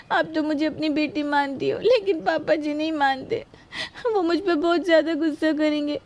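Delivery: crying, frightened plea; tearful pleading. Acted. Daughter-in-law begs mother-in-law to hide it from father-in-law, crying. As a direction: beg for protection through tears. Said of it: "रो मत, रोना बंद कर।".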